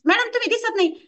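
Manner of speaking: high-pitched imitation of a puzzled client; questioning client. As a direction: raise the pitch, acting a client chasing the coach. Acts client calling absent coach.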